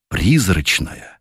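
plain reading voice

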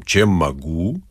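low, slow, stately bass; unexpected bass. A narrator's reading. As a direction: drop low and draw it out. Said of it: "неожиданным басом". (протяжный низкий бас)

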